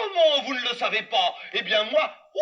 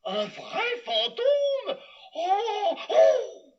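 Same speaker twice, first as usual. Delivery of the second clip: high trembling frightened voice; high, slow panicky exclamations; terrified; much slower than usual. Acted. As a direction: quake before the ghost. Terrified of ghost.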